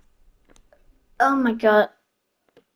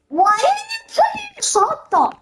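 much higher squeaky repeat; high silly voice; 11 semitones higher than usual. Acted.

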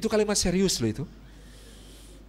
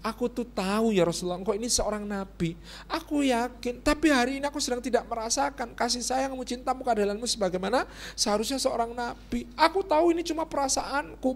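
softer, higher earnest plea; wife's true meaning; 5 semitones higher, 5 dB quieter than usual. Acted. Aisyah explains her feelings to husband, soft earnest plea. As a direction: confide feelings gently, pitched up. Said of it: "Aslinya berbunyi".

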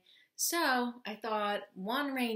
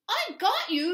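higher, urgent call; mild yell; 10 semitones higher, 4 dB louder than usual. A narrator's reading. Hedgehog grabs Mole's foot, mild high yell. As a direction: call out to a friend in danger. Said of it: "yelled Little Hedgehog".

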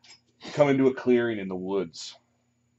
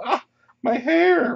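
vain elf yelling; 7 dB louder, slower than usual. Acted. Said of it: "they'll yell".

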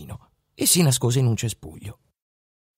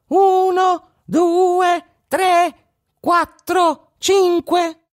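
tiny high voice echoing the count, loud; mocking echo; 6 dB louder, much slower than usual. A narrator's reading. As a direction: mimic the robber's counting from the bush. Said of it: "Cecino ripete". (fa il verso con vocina acuta)

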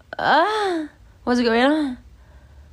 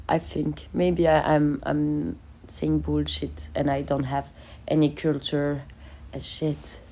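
quiet mumble; 7 semitones lower, 6 dB quieter, slower than usual. Real, not acted. Embarrassed self-deprecating mumble.

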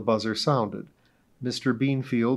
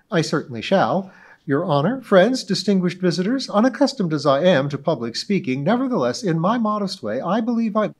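high, loud, grandly self-important society lady; pompous witness; 7 semitones higher, 6 dB louder than usual. Acted. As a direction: orate as if giving a club speech. Mrs Donahue pompous speech role.

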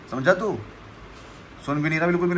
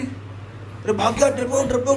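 teasing the dog; 7 semitones higher than usual. Real, not acted. Teasing dog that ran away.

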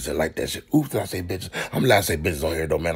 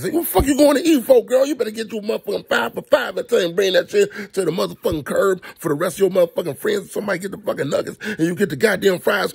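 higher, exasperated telling-off; scolding the screen; 10 semitones higher, 3 dB louder than usual. Real, not acted. Talking back to the girl in the movie, outraged scolding.

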